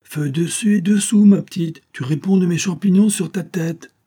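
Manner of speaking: happy, booming kitchen command; joyful shout. A narrator's reading. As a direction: shout cheerful orders to the maid. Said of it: "criait joyeusement à la servante".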